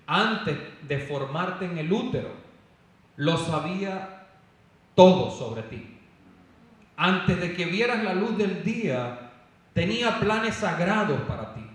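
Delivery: loud, slow preaching delivery; forceful scripture proclamation. Real, not acted. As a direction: proclaim the verse to the whole congregation, spacing it out. (proclamación bíblica con fuerza, lenta y en voz alta)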